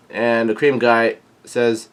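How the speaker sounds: plain reading voice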